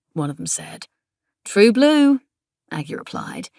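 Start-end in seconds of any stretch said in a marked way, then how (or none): 1.4-2.2 hammed-up broad Aussie, high and loud; proud, exaggerated Australian twang; broad Australian accent. A narrator's reading. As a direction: lay the Australian accent on thick. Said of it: "laying it on a bit thick".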